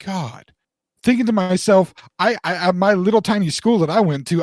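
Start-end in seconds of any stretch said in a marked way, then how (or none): 0.0-0.5 retold stunned reaction, low and soft; hushed awe. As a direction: say it under your breath, awestruck. Retold stunned reaction to watching actor.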